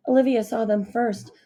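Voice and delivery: usual speaking voice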